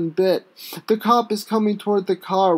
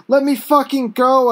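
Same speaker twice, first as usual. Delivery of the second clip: loud, higher, near tears; desperate shout; 4 semitones higher, 5 dB louder than usual. Acted. Pinned down by the cop, he shouts, near tears. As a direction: yell it while pinned to the ground. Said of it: "I shout, almost crying".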